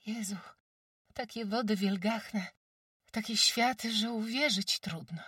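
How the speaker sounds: put-on high, wide-eyed village girl; amazed girl's voice. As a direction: gasp it out in wonder. Jagusia amazed.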